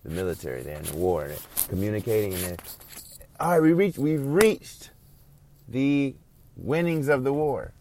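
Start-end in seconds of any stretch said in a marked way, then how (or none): 3.4-7.7 much higher and louder, triumphant; excited preacher's triumphant shout; victory cry. Acted. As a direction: shout it like the war is won. Acts out a team's victory.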